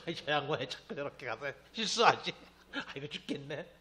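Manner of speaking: a hint of a rueful campaign staffer, laughing; laughing mock aide. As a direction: laugh through a mock complaint. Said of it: "윤석열 캠프 같아요".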